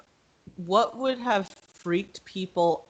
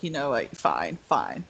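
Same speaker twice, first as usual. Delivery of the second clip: much lower, grudging "fine"; giving in; 6 semitones lower, 4 dB quieter than usual. Pretend. Loki caving to Valkyrie, low grudging fine. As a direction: sigh out a reluctant surrender. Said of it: "he's like".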